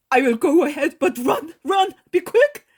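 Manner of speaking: high, loud, hurried urging; an old man driving her to hurry; urgent old man. Acted. Old man urges Ellie to hurry.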